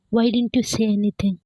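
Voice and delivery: usual speaking voice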